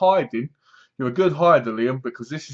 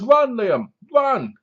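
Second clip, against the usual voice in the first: higher, louder cry of warning; a raised, pitched-up shout to run; urgent shout; 8 semitones higher, 4 dB louder, slower than usual. Acted. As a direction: yell at the kid to flee. Acted urgent call to child.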